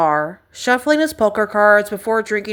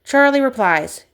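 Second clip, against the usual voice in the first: plain reading voice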